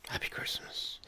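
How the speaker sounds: breathy whispered aside. Real, not acted. A quick whispered Happy Christmas to listeners.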